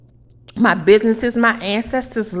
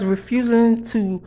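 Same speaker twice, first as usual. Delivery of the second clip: ordinary talk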